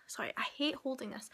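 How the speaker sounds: soft, quiet apology; whispered aside. Real, not acted. Aside about holding the camera.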